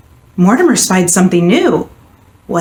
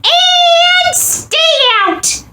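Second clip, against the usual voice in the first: high, bossy little squeak; squeaky mouse voice; much slower than usual. A narrator's reading. Mouse ordering the statues out, bossy squeak. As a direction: order them off like a stern tiny mouse. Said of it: "he said".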